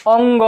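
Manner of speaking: अंग sounded through the nose; nasal sound demo. Shown as a demonstration. Teacher showing students which letters come through the nose, nasal demo. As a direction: let अंग resonate in the nose. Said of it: "यानी नाक से जो बोलते हैं".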